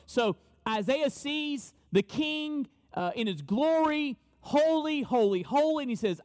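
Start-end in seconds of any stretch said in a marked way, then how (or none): none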